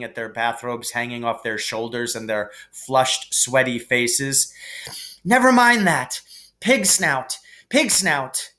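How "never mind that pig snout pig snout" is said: panted. A narrator's reading. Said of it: "panted Harry".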